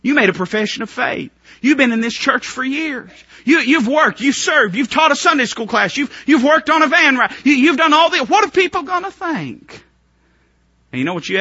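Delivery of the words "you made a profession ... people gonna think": high, loud accusing list; the devil taunting a churchgoer, loud; preaching at full force. Acted. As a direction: hammer each charge harder.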